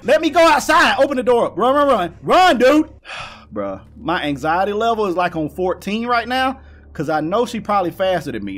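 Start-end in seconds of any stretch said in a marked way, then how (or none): 0.0-2.9 high, loud frantic shouting; panicked urging. Real, not acted. Gamer fleeing the house, panicked urging. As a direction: urge escape in a panic.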